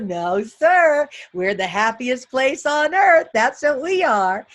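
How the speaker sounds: loud, slow, emphatic declaration; proud rallying cry. Shown as a demonstration. Speaker to cafeteria staff, loud proud rallying declaration. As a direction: stretch the slogan out and belt it proudly.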